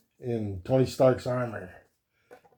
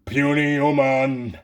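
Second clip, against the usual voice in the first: Hulk voice; slower than usual. Pretend. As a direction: play the Hulk as a slow, boasting brute. Voicing Hulk toy.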